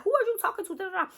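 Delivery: high-pitched, indignant challenge; acted confrontation. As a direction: imitate a friend butting in, pitch shooting up. Acting friend confronting the man.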